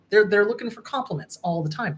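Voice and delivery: ordinary delivery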